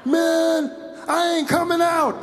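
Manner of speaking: high, slow yelled defiance; holed-up suspect yelling; suspect shouting. Acted. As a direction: yell out from hiding.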